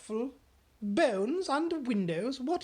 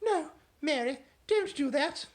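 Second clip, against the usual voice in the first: high, loud sharp no; sharp command; 12 semitones higher, 7 dB louder than usual. Acted. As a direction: stop her sharply. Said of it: "spoke so sharply".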